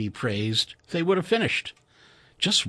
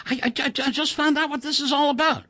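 put-on high, stammering young American engineer; eager colleague spilling a secret, pitched high; excited Chuck; 14 semitones higher, faster than usual. A narrator's reading. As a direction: blurt it out, tripping over the first word. Narrator voices Chuck.

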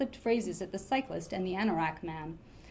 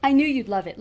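pleased and louder, voicing Liliana; a happy, eager line from a friend; 3 semitones higher, 8 dB louder than usual. A narrator's reading. Friend delighted her pick is a hit, happily. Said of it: "Liliana said happily".

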